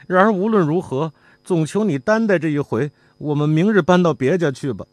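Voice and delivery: son apologizing. A narrator's reading. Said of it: "那小子一面对管事的说".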